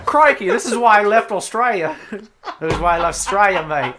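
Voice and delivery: loud, laughing mock Australian accent. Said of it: "Australian accent".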